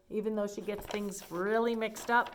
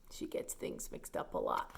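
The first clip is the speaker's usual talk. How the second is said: whispered aside; 9 dB quieter than usual. Real, not acted. Aside to listeners.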